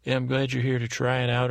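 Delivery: normal talking voice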